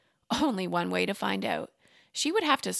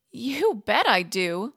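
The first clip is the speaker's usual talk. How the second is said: eager yes; 3 semitones higher, 5 dB louder, slower than usual. A narrator's reading. Maria eagerly accepts.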